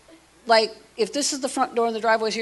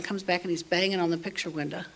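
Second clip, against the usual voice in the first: normal talking voice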